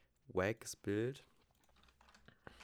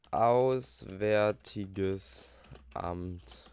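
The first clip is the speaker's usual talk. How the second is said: drawn out; 2 semitones lower, 7 dB louder, much slower than usual. Acted. Drawn out slowly.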